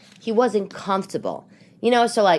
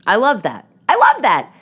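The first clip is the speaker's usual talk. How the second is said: delighted, building louder and higher; gushing approval; 5 semitones higher, 6 dB louder than usual. Real, not acted. Podcaster reacting to marriage advice, gushing approval. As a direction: gush it, then gush it bigger. Gushing over the quote she read.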